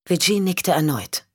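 plain reading voice

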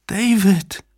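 high, anguished cry of the name; calling voice. A narrator's reading. The beloved's voice still calls David, high cry. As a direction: call him back, high and pleading. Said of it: "immer noch rief diese Stimme".

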